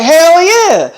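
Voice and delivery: high, loud group-style cheer; eager cheer. Retold or imitated. Friends answer Selena's money offer, eager cheer. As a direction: whoop it out like thrilled friends.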